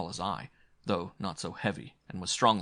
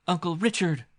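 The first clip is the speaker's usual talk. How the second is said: voice catching in a sob; 10 semitones higher, 6 dB louder than usual. A narrator's reading. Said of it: "her voice catching in a sob".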